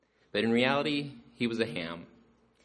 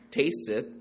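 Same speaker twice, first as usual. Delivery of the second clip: usual speaking voice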